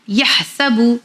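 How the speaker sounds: "यह सबू" with short sa and u-sound bu; corrected short vowels. Shown as a demonstration. Teacher giving the correct reading, "यह सबू" with short sa. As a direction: say "यह सबू" with breathy h, short स, clear बू. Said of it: "भी नहीं पढ़ना है".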